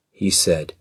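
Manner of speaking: ordinary delivery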